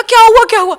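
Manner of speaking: high, loud retold worried call; alarmed shout. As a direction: call out in sudden worry. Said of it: "मैंने कहा".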